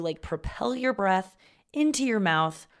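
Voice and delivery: normal talking voice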